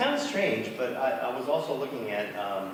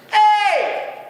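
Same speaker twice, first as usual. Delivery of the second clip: high, loud call upward; 11 dB louder than usual. Acted. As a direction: moose calling to his guests above. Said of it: "he called out".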